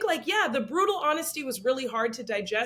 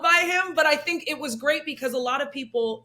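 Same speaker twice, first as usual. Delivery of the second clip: usual speaking voice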